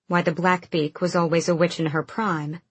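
ordinary delivery

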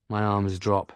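ordinary delivery

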